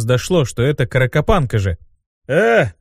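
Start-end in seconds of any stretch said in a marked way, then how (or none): 2.3-2.7 short, higher bleat. Acted. Imp butting in, bleating. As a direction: bleat it like a timid goat. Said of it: "проблеял Руперт".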